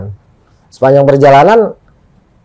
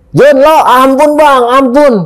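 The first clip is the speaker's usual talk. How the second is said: high, slow begging; retold frightened plea; 9 semitones higher, slower than usual. Checking on Jun, who begs mercy in high pleading. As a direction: imitate a scared cry for mercy.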